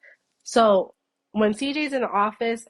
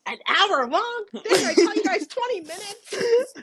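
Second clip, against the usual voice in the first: high, loud, mock-outraged editor; 10 semitones higher, 4 dB louder than usual. C J the editor scolding the podcasters about length, high and mock outraged. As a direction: complain like the overworked editor. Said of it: "he's gonna be like".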